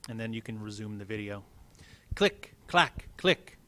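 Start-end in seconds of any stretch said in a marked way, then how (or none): 2.1-3.6 abacus clicks. Retold or imitated. Abacus sound imitated.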